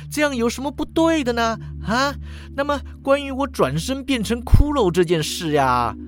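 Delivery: high-pitched, lightly hammed earnest pleading; earnest appeal. A narrator's reading. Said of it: "语气诚恳地说". (高声诚恳地辩解)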